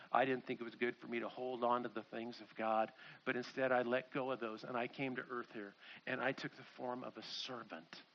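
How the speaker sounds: low, soft, self-effacing; quiet humility. Acted. Jesus explains letting go to become a servant, quiet humility. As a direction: speak it gently, almost to yourself.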